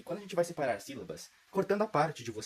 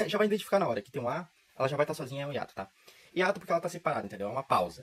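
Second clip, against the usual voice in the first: ordinary delivery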